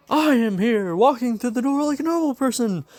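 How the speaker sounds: high, loud heroic proclamation; booming hero entrance. Acted. Hero bursts in on his class, high triumphant announcement. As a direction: announce yourself in a high, full-voiced hero cry. All Might bursts through the door.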